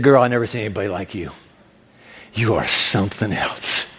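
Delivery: low, affectionate Jesus voice; tickled, tender praise; smiling admiration. Acted. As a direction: say it low, with a smile. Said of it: "and he's smiling".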